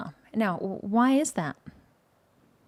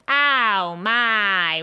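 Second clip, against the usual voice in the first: twang at full setting, louder and held; 4 dB louder than usual. Shown as a demonstration. Vocal coach demonstrating the twang knob at a hundred, full twang. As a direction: crank the twang all the way up. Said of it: "twang knob at a hundred".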